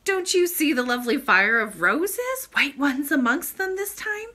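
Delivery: high, loud, pleading princess; baffled child's urgent question; bewildered. A narrator's reading. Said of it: "almost as bewildered as he".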